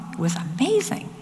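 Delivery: whispered "It was amazing."; hushed awe. Real, not acted. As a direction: breathe it out in wonder. Moved recalling Mike.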